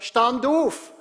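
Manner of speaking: loud, high command; commanding shout. Acted. Jesus ordering the dead man up, loud commanding shout. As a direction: bark the order to rise.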